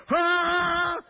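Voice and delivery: sudden high, loud "Ah!"; excited plant yell. Retold or imitated. The tomato plants growing crazy, a sudden high loud yell. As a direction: burst out like a thrilled tomato plant. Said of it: "these tomato plants are going".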